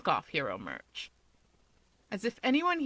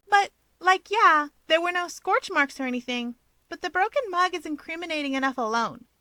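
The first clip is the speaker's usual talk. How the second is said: much higher, louder, pointed; suspicious woman; 9 semitones higher, 5 dB louder than usual. A narrator's reading. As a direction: press the point. Rumi voiced.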